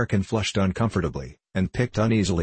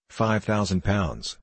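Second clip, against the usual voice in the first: plain reading voice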